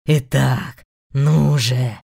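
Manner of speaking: loud, low impatient beast snarl; demon growl. Acted. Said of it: "рыкнул зверь". (рык зверя)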